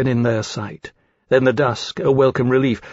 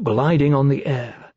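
ordinary delivery

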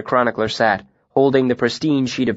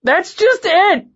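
high, loud, slow hysterical cry; 4 dB louder, slower than usual. A narrator's reading. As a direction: near breaking, almost a scream. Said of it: "his voice high and hysterical".

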